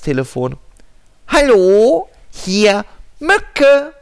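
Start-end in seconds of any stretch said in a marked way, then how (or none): 1.3-3.9 high, loud squeaky mosquito; tiny mosquito voice. Acted. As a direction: chirp it like a tiny insect. Mosquito answers.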